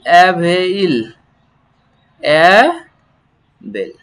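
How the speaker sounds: stretched very slowly; very slow teacher demo; drawn-out syllable. Shown as a demonstration. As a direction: hold each sound for the class. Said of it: "teacher models the syllable".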